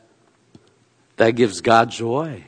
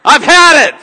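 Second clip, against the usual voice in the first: higher, louder fed-up father; mock angry dad; 12 semitones higher, 10 dB louder than usual. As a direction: bark it like a dad at the end of his rope. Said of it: "He does not drive and say".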